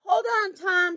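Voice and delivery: high-pitched urgent call; storybook shout. A narrator's reading. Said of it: "shouted Grandude".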